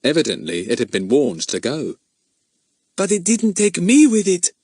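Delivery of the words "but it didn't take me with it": high, louder triumphant voice; exultant. A narrator's reading. The rescued prince gloats, high and exultant. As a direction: crow with triumph. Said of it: "said the prince exultantly".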